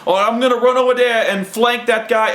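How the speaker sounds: imitated eager gamer, pitched high; high, excited mock gamer voice; excited player. Acting Mike's excited game move.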